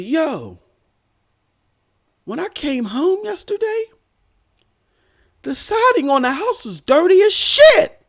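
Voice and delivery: high-voiced retold nagging; imitated insistent wife. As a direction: act out a wife's urgent complaint. Wife's complaint acted out.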